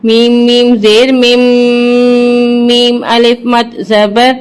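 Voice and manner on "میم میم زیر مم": slow drawn-out مم with held nasal ghunna; spelling out Quran letters, مم stretched with ghunna; Quran recitation spelling. Shown as a demonstration. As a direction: recite مم slowly, holding the doubled میم. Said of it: "اس کو سختی کے ساتھ پڑھیں گے".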